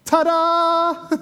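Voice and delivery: high, loud triumphant flourish; showman's ta-da. Acted. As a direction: announce it like a magic trick reveal. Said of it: "and says".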